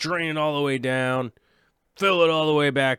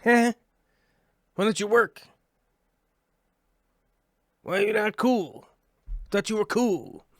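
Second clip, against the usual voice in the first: whiny pouting; 9 semitones higher than usual. Real, not acted. Scolding the broken XSplit software.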